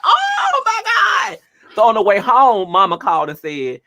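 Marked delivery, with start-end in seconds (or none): 0.0-1.4 high, slow drawn-out laughing shout; shocked laughter at the betrayal; shocked laughing cry. Real, not acted. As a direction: let the laugh burst through it. Shocked laugh at Pooh's remark.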